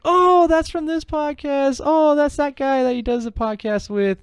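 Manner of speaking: high, bright excited recognition; excited realization. Retold or imitated. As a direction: gasp it out like spotting a reference. Imitating himself recognizing references.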